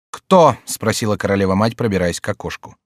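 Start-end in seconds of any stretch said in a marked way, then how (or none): 0.1-0.6 high, loud demand; sharp question. A narrator's reading. Queen mother rushing to the window, sharp question. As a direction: fire the question out.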